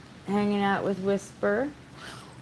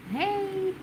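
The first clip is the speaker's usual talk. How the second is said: surprised hey. Real, not acted. Dog interrupts.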